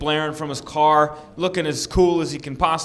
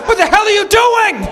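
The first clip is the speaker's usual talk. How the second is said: very high, loud mock scream; hammed-up outraged screech; outraged yell; 8 dB louder than usual. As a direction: shriek it like a frantic shelter worker. Acts out outraged shelter worker.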